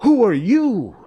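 high, loud demand; crying out. A narrator's reading. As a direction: challenge a stranger with a sharp cry. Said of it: "and cried out".

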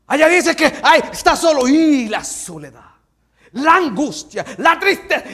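high, loud dramatic listing; dramatic full-force sermon delivery; forceful preaching. Acted. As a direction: shout each affliction out from the pulpit.

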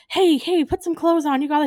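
hammed-up mothering yell; mock alarmed shout. Mother hen shouting at grown man, mock shout. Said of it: "and she's like".

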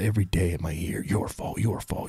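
hissed whisper of a tormenting inner voice; whispered accusing voice. Retold or imitated. Man voicing the accusing voice in his head in a whisper. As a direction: whisper the accusations like a voice in your ear.

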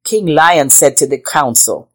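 ordinary delivery

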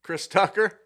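a loud, high, disbelieving echo of the name; surprised. Real, not acted. Guest reacting to a friend's odd narrator pick, surprised. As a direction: toss the name back in real disbelief.

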